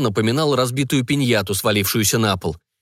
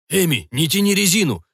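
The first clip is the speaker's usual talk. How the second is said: shouted; 7 semitones higher than usual. A narrator's reading. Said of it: "прокричал Ник".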